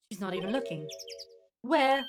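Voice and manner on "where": put-on French accent. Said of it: "My French accent is amazing".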